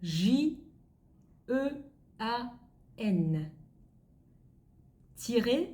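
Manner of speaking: slow French letter names, spaced apart; slow spelling. Shown as a demonstration. Teacher dictating French names to learners, slow letter by letter. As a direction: spell it out slowly, pausing after each letter. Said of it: "Chị sẽ đọc chậm thôi ạ".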